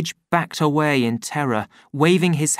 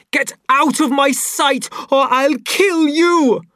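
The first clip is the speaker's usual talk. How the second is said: high, loud, slowing menace; murderous threat; 13 semitones higher, 5 dB louder than usual. A narrator's reading. Savage threatening Lenina on the floor. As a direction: threaten to kill her.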